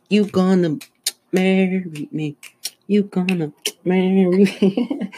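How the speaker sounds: high, slow, hammed-up pleading proposal; proposing to a crush in the punchline, high and drawn out. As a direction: sing it out high and slow like a corny suitor.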